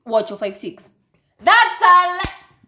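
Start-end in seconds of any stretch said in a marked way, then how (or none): 1.4-2.7 high, drawn-out outburst of disbelief; loud protest. Real, not acted. Disputing the co-host's height, loud and high. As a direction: cry it out slowly, far above usual pitch.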